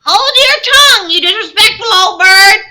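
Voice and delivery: very high, loud parrot squawk; screeching parrot. A narrator's reading. Parrot scolds the old lady, shrill loud screech. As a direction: shriek it like an outraged parrot. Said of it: "cried Polly".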